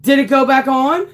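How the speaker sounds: high, urgent question; real startled alarm. Real, not acted. Streamer asking if the stream is back, real alarm. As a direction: check anxiously after a scare. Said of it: "that was like a heart attack".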